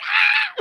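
high squeal of joy breaking into laughter; sudden shriek then giggles; delighted squeal. Retold or imitated. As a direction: squeal like seeing your best friend. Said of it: "I was like".

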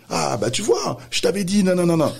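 high, excited told-you-so; proud mother. Retold or imitated. Kid's mother in background, excited and high. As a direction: crow it like a proud mum. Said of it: "sa mère derrière qui dit".

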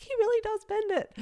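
high, astonished squeal of disbelief; amazed. Retold or imitated. Watching him bend the ball, she is floored and shrieks in amazement. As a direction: gasp it out, mind blown.

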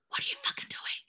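angry, voiceless whisper in retelling; hushed swearing. Retold or imitated. Mother challenging the doctor dabbing her, furious whisper. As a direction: whisper the curse at him. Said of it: "I'm trying to hushly swear".

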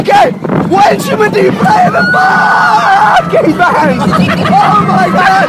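very high, loud strained yelling; screaming the question. Real, not acted. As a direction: scream the question over the ride. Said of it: "You can hear me screaming for my life".

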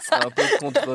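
words broken by laughter; amused, laughing aside. Real, not acted. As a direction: giggle through it. Host cracks up at joke.

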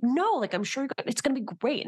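high breezy reassurance; eager to please. Retold or imitated. People-pleaser brushing off notes.

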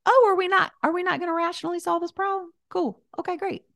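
high, quick mock-panicked chatter; panicky brain talking. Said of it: "our brains ... shimmy where they're like".

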